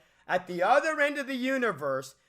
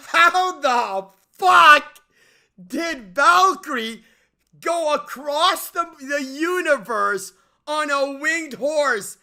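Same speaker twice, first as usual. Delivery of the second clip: incredulous shout; 5 semitones higher, 10 dB louder, slower than usual. Real, not acted. Outraged at the movie's plot hole.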